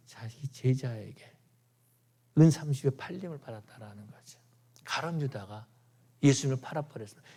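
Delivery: a grieving whisper; hushed whisper. Acted. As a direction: lean in and whisper the betrayal. Grief over the betrayal by Judas.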